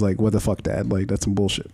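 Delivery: ordinary talk, nothing marked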